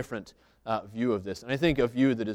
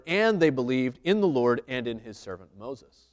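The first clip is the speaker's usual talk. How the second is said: scripture reading; 6 dB louder than usual. Acted. Reads the scripture passage aloud.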